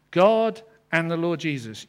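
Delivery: usual speaking voice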